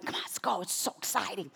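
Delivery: soft, conspiratorial eagerness; retold hushed excitement. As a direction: play eager women quietly urging each other on. Acts women pairing up to go.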